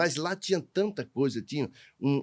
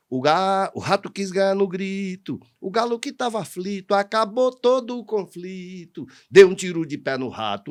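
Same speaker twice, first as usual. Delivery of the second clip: higher, louder rhymed declamation; verse recitation; 7 semitones higher, 7 dB louder than usual. Shown as a demonstration. Storyteller recites how the rat challenged the rooster, declaimed verse.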